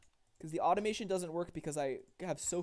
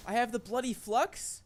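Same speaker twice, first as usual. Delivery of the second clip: loud, slow disbelieving question; can't believe the news; shocked; 8 semitones higher, 11 dB louder, slower than usual. Real, not acted. Shocked at disease.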